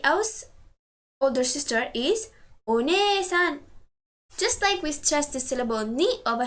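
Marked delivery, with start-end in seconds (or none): 2.6-3.6 "one-san" with a long, stressed ne. Shown as a demonstration. Teacher modelling someone else's older sister, long "ne" in "one-san". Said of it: "here we stress the syllable".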